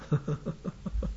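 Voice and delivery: soft, breathy chuckle. Real, not acted. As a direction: chuckle quietly to yourself. Amused by name-calling in text.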